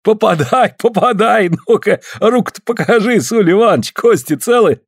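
high, lively mock-urging; amused teasing. A narrator's reading. Afanasy jokes about Suль's injured hand, amused teasing. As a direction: tease him in a high, merry voice. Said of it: "хохочет".

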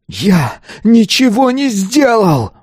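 slow, very high outcry; desperate scream. A narrator's reading. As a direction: scream the denial, stretching every word. Pupil's denial becomes a scream. (отчаянный протяжный крик)

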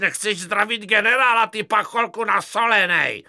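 furious high loud shout; raging general. Acted. Enraged general insulting a soldier, furious yell. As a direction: bellow it in a mad rage.

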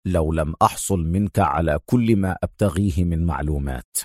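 stern, low-pitched threat. A narrator's reading. Adham threatening his rival for information, stern. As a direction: warn him in a deep, severe voice. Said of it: "في صرامة وهو يقول".

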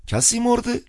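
put-on high alarmed old man; old man asking if someone died; old priest voice. A narrator's reading. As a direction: blurt it like an old cleric.

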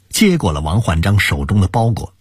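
ordinary delivery, nothing marked

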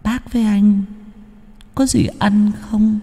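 very high, thin ghostly voice with a breathy giggle; eerie ghost girl. A narrator's reading. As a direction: lure them away, hungry and lonely ghost. Ghost begs for food, lures. (ma nữ rủ rê, giọng cao vút rợn người)

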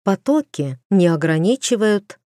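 usual speaking voice

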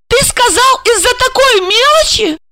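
high-pitched, incredulous wife; outraged cry. A narrator's reading. Wife repeating husband's word to him, outraged cry. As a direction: demand it indignantly, far up in pitch. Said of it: "закричала она".

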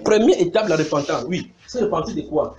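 ordinary talk, nothing marked

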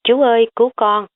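high pleading call for uncle; calling for help. A narrator's reading. Niece calling her uncle to save her, high plea. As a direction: cry out to be rescued, half playful. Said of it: "cô kêu lên".

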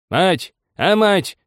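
high, loud summons; shouting. A narrator's reading. Old man calls his wife, high shout. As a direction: holler for someone next room. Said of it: "крикнул он, отдышавшись".

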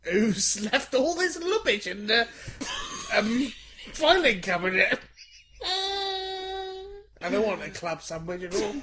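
put-on creaky old lady squeak, drawn out slowly; old Chinese lady. S1 auditions the old lady line, giggling, slow and high. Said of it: "I think you really nailed that part".

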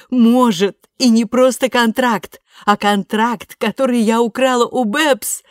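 high, loud gleeful bragging; triumphant boast. A narrator's reading. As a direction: crow over the victory.

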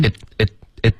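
IT cut short, tongue tip holding the t; tongue-tip stop. Shown as a demonstration. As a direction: repeat IT with an unreleased t. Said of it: "你要顶舌尖". (“it”顶舌尖停顿)

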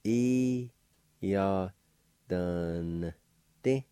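E, ya, dun, ti held out one by one; syllable by syllable. Shown as a demonstration. Teacher demonstrates the second part to learners, syllable by syllable. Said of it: "N sound with the D V like this".

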